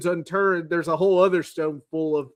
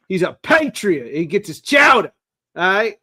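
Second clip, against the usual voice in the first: a hammed-up Boston accent, a bit higher and louder; mock Boston sports fan, proud of his team; playful Boston accent, teasing the Patriots; Bostonian accent; 3 semitones higher, 6 dB louder than usual. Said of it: "that was my Bostonian accent".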